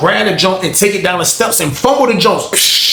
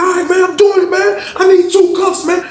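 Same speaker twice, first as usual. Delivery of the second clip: high, strained begging; retold pleading. Honey bun man begging officers for two cuffs, high pleading. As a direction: beg while complying.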